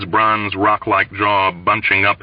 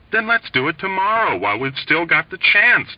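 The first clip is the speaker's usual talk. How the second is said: pleading; 9 semitones higher than usual. A narrator's reading. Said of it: "Dobbs pleaded".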